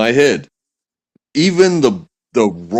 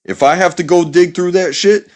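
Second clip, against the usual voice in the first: higher-pitched dad laying down the law; stern lecturing dad; 5 semitones higher than usual. Acted. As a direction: scold a kid in a no-excuses dad voice. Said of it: "you seem like you're this type of dad".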